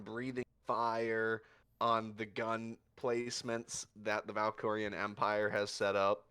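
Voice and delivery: usual speaking voice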